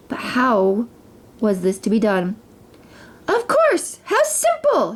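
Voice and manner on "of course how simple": high, gleeful queen's exclamation; queen's gleeful cry of discovery; gleeful shout. A narrator's reading. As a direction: burst out with delight. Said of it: "shouted the queen".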